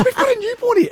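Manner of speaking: high, mock scolding wife voice; wife hisses at husband home late, mock high scold; mock shrill wife. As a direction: squeak it out like a cross wife.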